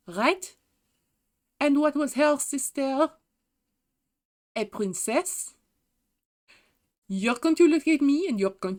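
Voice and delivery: hammed-up outrage, stressing key words; indignant rant. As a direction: argue it with incredulous questions. Acting out a famous indignant rant.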